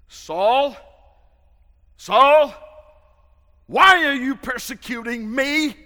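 very loud, high booming call of God; voice from heaven. Acted. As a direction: boom it out like a voice from heaven. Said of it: "hears a voice saying to him".

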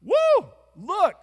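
high, loud amazed exclamation; excited disciple. Acted. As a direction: cry out in wonder at the withered tree. Disciple voiced exclaiming at fig tree.

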